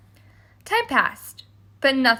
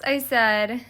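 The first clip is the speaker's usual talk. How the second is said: plain reading voice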